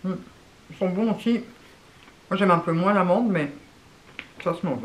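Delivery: eating crunchy snack; chewing while talking. Real, not acted. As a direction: mouth full of biscuit. Seen 1: Woman holds Pocky stick, bites it and chews throughout.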